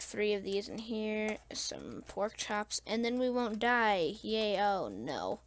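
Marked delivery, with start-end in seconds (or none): none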